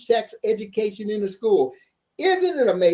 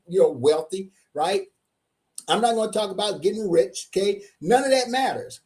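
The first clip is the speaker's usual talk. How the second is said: ordinary delivery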